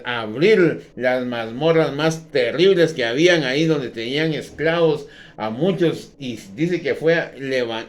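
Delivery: loud, fervent pulpit delivery; fiery sermon voice; preaching at full force. Acted. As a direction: thunder it out to the congregation.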